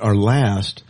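ordinary talk, nothing marked

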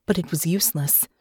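usual speaking voice

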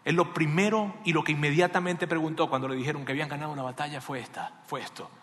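normal talking voice

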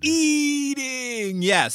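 exaggerated drawn-out confirmation; emphatic yes. Real, not acted. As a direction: sing out Eating triumphantly. Confirming the guess.

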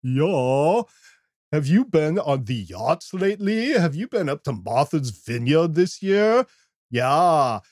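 put-on upper-crust yacht-club accent; mock upper-crust accent, rising on the questions; elite yachtsman. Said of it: "the podcast for the elite yachtist".

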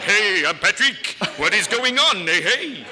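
loud, slow cartoon bear greeting; Yogi Bear voice. Retelling Scott's impression, loud Yogi Bear voice. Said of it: "he did you as Yogi Bear".

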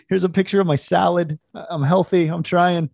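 perky, mocking the old lunch posts; mock cheery poster.

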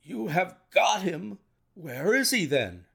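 high, amazed questioning; amazement. A narrator's reading. Colonel hearing the murderer is caught, amazed. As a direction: ask it in astonishment. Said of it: "stared at him in amazement".

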